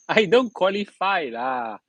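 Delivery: chuckling Singlish brush-off; laughing. Real, not acted. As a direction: laugh it off, slow and amused. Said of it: "A joker some more".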